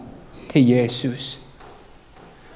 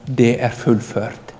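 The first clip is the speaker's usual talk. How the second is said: hushed final words; 6 semitones lower, 8 dB quieter, much slower than usual. A narrator's reading. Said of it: "så sier han".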